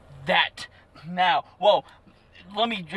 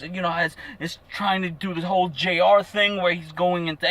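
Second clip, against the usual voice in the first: ordinary delivery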